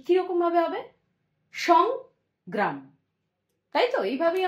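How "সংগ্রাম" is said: সংগ্রাম said very slowly as সং and গ্রাম; syllable by syllable. Shown as a demonstration. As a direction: stretch সংগ্রাম into two slow syllables. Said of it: "এক নিঃশ্বাসে উচ্চারণ করার চেষ্টা করো". (সংগ্রাম ধীরে দল ভাগ করে উচ্চারণ)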